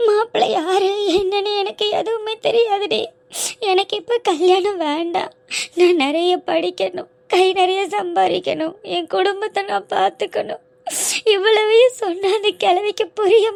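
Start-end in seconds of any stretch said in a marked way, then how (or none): none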